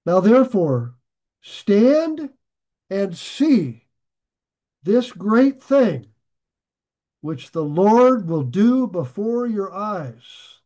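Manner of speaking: slow, loud and commanding, every word weighed; booming pulpit reading of a prophet's command; dramatic scripture reading. Acted. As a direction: proclaim it like the prophet calling the people to witness.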